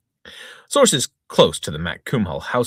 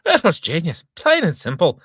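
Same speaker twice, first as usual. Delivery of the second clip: louder, higher hearty publican; pub owner declaring; 10 semitones higher, 6 dB louder than usual. Acted. Pub owner praising the wife, hearty. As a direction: declare it proudly across the bar. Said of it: "declared local pub owner".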